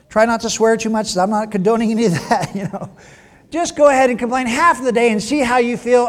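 playful teasing permission; mock permission. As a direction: egg them on playfully.

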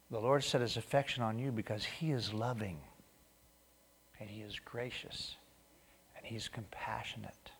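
softening to a whisper, tender; tender hush. Real, not acted. Pastor gently assuring the congregation of God's love, hushed. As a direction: let it fade to an awed hush.

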